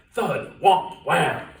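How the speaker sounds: loud, punchy sound words; booming wham. A narrator's reading. Narrator acting out the heavy boots, loud punchy sounds. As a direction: bang out each sound.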